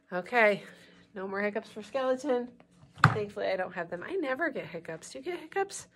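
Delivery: usual speaking voice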